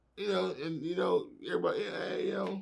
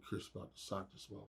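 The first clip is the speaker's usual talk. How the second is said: much softer, fast muttered aside; quiet aside; 7 semitones lower, 21 dB quieter, much faster than usual. Retold or imitated. Muttering to himself as the fight starts, softer. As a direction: mutter it under your breath. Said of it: "aside to himself".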